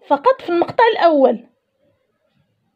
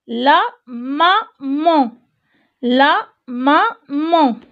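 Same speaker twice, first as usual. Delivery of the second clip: drawn-out syllables, la maman read very slowly; slow model reading; much slower than usual. Shown as a demonstration. Teacher reading la maman to young pupils, very slow and clear. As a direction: spell la maman out slowly for beginners. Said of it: "teacher models reading for class".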